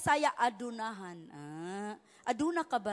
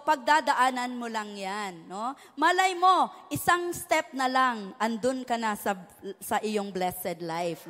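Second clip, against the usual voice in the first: normal talking voice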